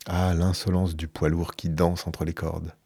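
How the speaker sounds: ordinary delivery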